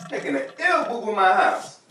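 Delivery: ordinary talk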